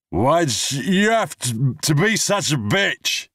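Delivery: put-on high, slow, slurred drunken cry; drunk slurred outburst. Drunk man to himself, slurred and bitter.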